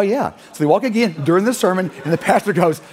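ordinary talk, nothing marked

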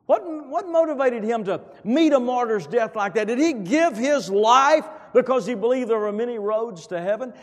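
high, loud rhetorical challenge; thundering pulpit question; preaching at full force. Acted. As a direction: press the congregation hard.

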